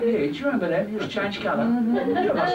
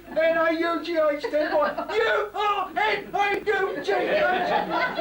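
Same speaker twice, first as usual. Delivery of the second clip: high, slow shouted letters; cheeky spelling out; 8 semitones higher, much slower than usual. Retold or imitated. Child correcting spelling.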